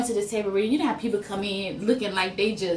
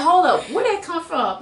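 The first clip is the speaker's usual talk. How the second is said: surprised; 7 semitones higher, 4 dB louder than usual. Retold or imitated. Retold shock at co-star's voice.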